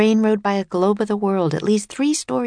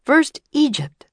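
higher, louder showman announcer; tour guide announcer; 9 semitones higher, 4 dB louder than usual. Acted. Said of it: "said the tour guide".

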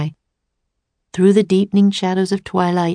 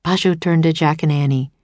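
ordinary delivery

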